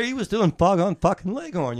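high, loud, laughing outburst. Real, not acted. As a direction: crack up while telling it. Laughing at Costner's accent.

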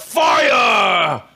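high, loud shout from the crowd. Acted. Someone in the crowd answers the speech, shouting. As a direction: shout it out across the hall. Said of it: "You hear someone shout".

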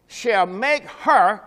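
"Shall make her" slowed right down, "her" leaned on; stress on her. Shown as a demonstration. Preacher pointing the congregation to one word, slow and stressed. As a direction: draw out "her" so they underline it. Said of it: "circle the word "her".

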